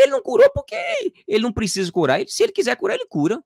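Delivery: high-pitched, re-enacted indignation; indignant retort. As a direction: snap back as if your faith were challenged. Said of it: "Aí subiu aquela raiva dizendo assim".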